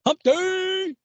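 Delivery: very high, stretched-out whoop; commercial camel. Retold or imitated. Co-host answering as the commercial's camel, high whoop. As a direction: whoop the punchline, dragging out the last word. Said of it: "I love that fucking camel".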